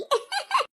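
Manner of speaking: high teasing giggle; mocking laugh. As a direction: burst into a short, high giggle. Group mocks the lisping bride. (खिल्ली उड़ाती हँसी)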